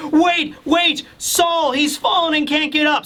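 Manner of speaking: high, urgent acted plea; high-pitched alarmed giant; frantic call. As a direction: call out in mock panic. Frantic giant calling for help.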